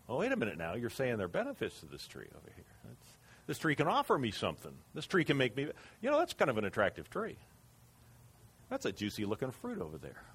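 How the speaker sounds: soft, hushed wondering to herself, quick in places; tempted musing. Acted. Eve musing to herself about the fruit, hushed. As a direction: lure yourself in, murmured.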